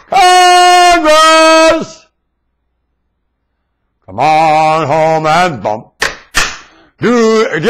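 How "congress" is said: high, loud long call across a hall; calling from far away. Acted. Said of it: "I'm gonna shout over here".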